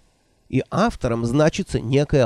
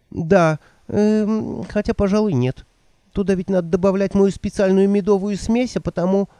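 put-on higher, fluttery old woman voice; 7 semitones higher than usual. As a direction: play the flustered old witch. Nanny voiced by narrator.

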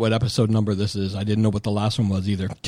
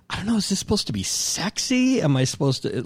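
baffled; 8 semitones higher than usual. Retold or imitated. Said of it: "And I was like".

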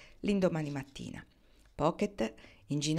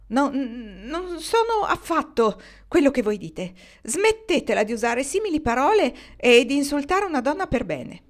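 higher, louder, sputtering outrage; flustered anger; 7 semitones higher, 7 dB louder than usual. A narrator's reading. Mrs Posey stung by an unknown word, higher, louder flustered anger. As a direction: splutter in offended fury. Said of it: "irosa e confusa".

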